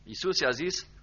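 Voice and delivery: normal talking voice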